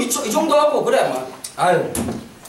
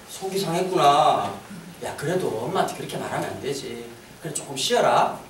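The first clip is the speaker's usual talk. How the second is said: low, soft, soothing murmur; gently calm an upset child; acted tender comfort, hushed and low; understanding mother; 12 semitones lower, 7 dB quieter than usual.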